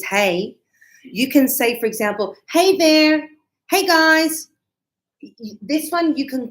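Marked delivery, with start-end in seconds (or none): 2.5-4.5 1 cheerful greeting. Shown as a demonstration. Teacher demos greeting call.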